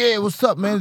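imitating a fired-up cousin; high-pitched, pumped challenge; hyped up. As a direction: act out a geared-up "what's up". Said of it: "var veldig sånn gira".

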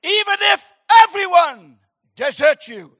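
loud, high theatrical vow; Shakespearean Peter. Acted. Peter vowing loyalty to Jesus, hammed-up Shakespearean. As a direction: declaim it like a Shakespeare hero. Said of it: "a spirit of Shakespeare came on him".

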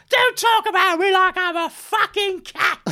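put-on high, slow indignant drunk woman; drunk woman impression. The drunk heckler's wife protesting, high and slurred. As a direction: squeal it like an offended drunk. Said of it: "She go".

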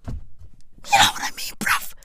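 whispered mock sob. Mock breakdown continues.

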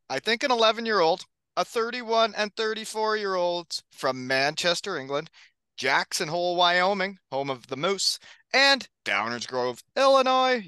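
big hype podcast announcer read; announcer intro. Acted. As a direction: introduce the guests like a showman. Host reads hype podcast intro.

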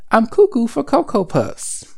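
high, excited cartoon bird; cereal mascot voice. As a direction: squawk it like a crazy cartoon bird. Quoting the cereal mascot slogan.

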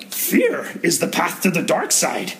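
slow, strange Yoda croak on fear. Retold or imitated. Youth pastor quoting Yoda to the kids, in a slow Yoda voice. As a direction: wise old Yoda, slow and deliberate. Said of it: "I'm gonna say this in the Yoda voice".

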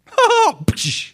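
high, loud mocking cackle of the devil; devil's mocking laugh. Devil laughing at a powerless believer, mocking cackle. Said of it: "he's gonna laugh".

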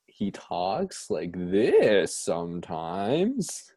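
slow, with pitch leaping on the wrong syllables; mock YouTuber sing-song, swooping up mid-phrase; odd inflections. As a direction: drag it out and accent the wrong syllables. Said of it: "his inflections are the weirdest".

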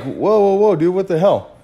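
high, startled whoa; retold shock. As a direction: recoil in disbelief at the message. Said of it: "And I'm like".

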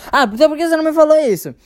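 high, loud, fast mom exclaiming; exasperated mom. Retold or imitated. Mom to João on the call, a high fast exclamation. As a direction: mom throwing it back at him, quick and sharp.

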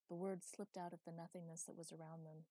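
plain reading voice

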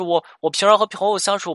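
eager cut-in. Retold or imitated. Client cutting in eagerly to answer the counselor. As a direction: jump in before he finishes. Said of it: "女生就抢答了".

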